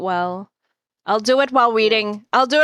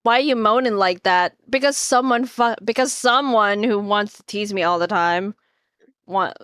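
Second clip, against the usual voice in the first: ordinary talk, nothing marked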